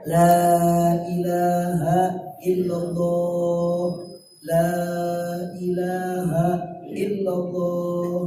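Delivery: very slow, low, soft la ilaha illallah; slow whispered talqin. Shown as a demonstration. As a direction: murmur la ilaha illallah slowly into the ear. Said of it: "Anda ngomong aja". (talqin pelan dan lembut di telinga)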